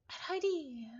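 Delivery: plain reading voice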